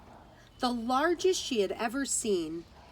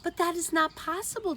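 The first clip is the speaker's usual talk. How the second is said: shouting; 7 semitones higher, faster than usual. A narrator's reading. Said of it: "Geraldine shouted".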